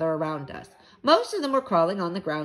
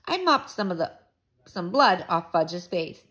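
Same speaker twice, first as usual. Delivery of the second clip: ordinary delivery, nothing marked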